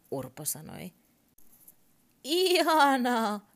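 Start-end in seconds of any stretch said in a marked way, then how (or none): 2.2-3.5 high, loud, slow neigh of a word; whinny the word like a horse. Acted. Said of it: "hirnahti Ihanaa".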